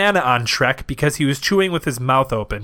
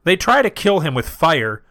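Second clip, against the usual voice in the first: usual speaking voice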